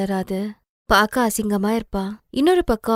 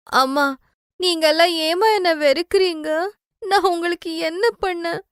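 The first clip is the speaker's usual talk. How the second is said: hurt pleading; 8 semitones higher than usual. Acted. Daughter pleads with hateful family.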